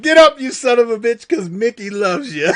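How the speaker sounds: hammed-up shout at the fighter; mock yell through laughter. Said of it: "I was like".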